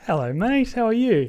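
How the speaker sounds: high, loud, bright-eyed hello to a mate; excited greeting. Real, not acted. Calling his friend Joe out of the blue, loud excited hello. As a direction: greet your best mate like you're thrilled he picked up. Surprise call to best friend.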